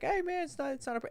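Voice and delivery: very high mock placating voice; imitated fake buddy. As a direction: deflect in a put-on high voice. Imitating friend deflecting the confrontation.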